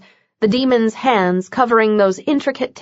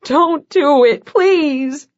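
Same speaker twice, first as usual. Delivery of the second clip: slow, higher pleading; higher pleading young man; begging; 6 semitones higher, much slower than usual. A narrator's reading. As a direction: plead desperately, through tears. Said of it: "Nathaniel begged".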